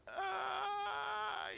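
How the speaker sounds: high excited shout of relief; sudden high yelp of excitement; excited cry. Real, not acted. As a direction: let out a high cry as you just make it. Ship narrowly makes it.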